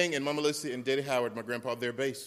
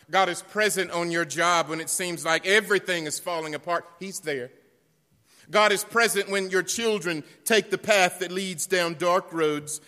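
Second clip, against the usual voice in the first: loud, higher preaching cadence; full-force preaching; 5 semitones higher, 10 dB louder than usual. Acted. Preacher drives home God is present, loud preaching. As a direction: thunder the refrain to the pews.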